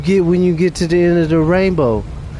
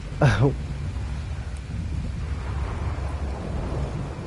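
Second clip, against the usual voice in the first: breathy laugh; 5 semitones lower, 11 dB quieter than usual. Real, not acted. Laughs at his own story.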